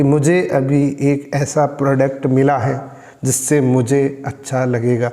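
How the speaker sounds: low, level and uninvolved; simple, toneless voice. Shown as a demonstration. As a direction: report the news with no excitement. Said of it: "वो एक simple voice है".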